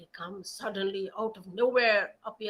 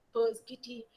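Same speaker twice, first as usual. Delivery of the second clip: plain reading voice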